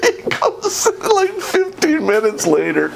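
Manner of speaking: high, breaking into laughter; laughing while telling. Real, not acted. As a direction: fight back real laughter while telling it. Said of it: "He is laughing hysterically".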